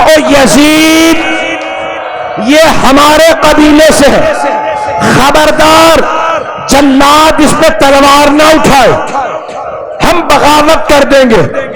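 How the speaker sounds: high, shouted warning; defiant shout. Acted. Slaves shouting their threat of revolt at Yazid. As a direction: roar the threat at the tyrant.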